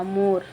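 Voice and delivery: मोर said with lips pushed into a round o; careful मोर with pursed lips. Shown as a demonstration. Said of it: "होठ को सिकुड़ाया".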